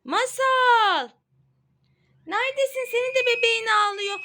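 second, stronger call. Real, not acted.

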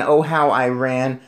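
ordinary delivery, nothing marked

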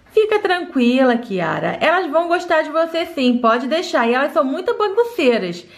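low reassuring mom; mother voice. Acted. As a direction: soothe the little one as mom.

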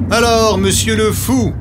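high, jeering tease; mocking villager. A narrator's reading. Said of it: "disent certains villageois".

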